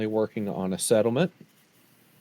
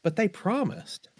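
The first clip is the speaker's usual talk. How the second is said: mock naive; 10 semitones higher than usual. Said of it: "I'll look at the woods like".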